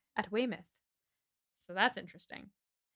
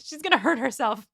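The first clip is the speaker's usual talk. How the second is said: higher, louder put-on complaint; mock indignant grumbling; 4 semitones higher, 5 dB louder than usual. Knightley fretting over Jane's hoarse singing, indignant. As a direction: protest crossly on another's behalf. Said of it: "grumbling about how Frank is".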